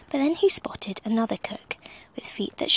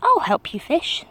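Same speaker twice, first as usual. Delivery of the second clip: a hint of a bold king, louder; helpful king; 6 semitones higher, 7 dB louder than usual. A narrator's reading. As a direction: offer help with gusto. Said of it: "said the hungry king".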